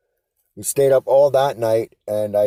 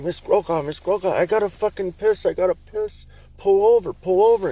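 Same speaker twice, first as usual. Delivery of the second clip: higher, urgent mock begging to pull over; desperate plea; 9 semitones higher than usual. Teen begging his buddy's mom to stop the car, mock urgent. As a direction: plead like you're about to burst. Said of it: "I'm like".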